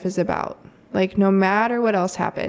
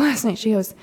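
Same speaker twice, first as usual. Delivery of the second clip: usual speaking voice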